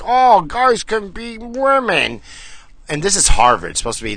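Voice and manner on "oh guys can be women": higher, slow put-on mocking voice; mocking quote. Mocking Harvard students in a slow put-on voice. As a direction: drag it out like a smug student. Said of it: "these guys are like".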